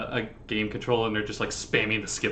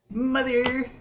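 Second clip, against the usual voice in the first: put-on old man voice; 12 semitones higher than usual. Old man calls for mother.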